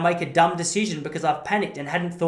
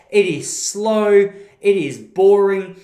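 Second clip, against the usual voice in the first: disgusted emphasis; 2 semitones higher, 6 dB louder, slower than usual. Real, not acted. Ranting against cricket.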